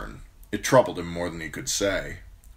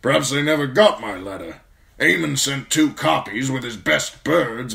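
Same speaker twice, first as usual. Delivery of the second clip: put-on loud, high old officer's voice; old commander, sour and resigned; 7 semitones higher, 7 dB louder than usual. Commander explains the capital's silence to steward, loud and sour. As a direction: air a grievance about being ignored.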